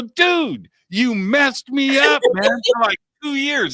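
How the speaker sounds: high, whiny accusing protest; telling the old friend he caused years of gloom, high and whiny; mock complaint. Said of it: "I go".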